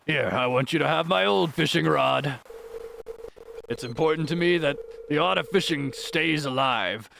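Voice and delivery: put-on old fisherman accent; Willy Wilson's voice. Fisherman giving the player an old rod, put-on seafarer voice. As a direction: hand on the craft as a kindly seafarer. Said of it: "give Willy Wilson's voice".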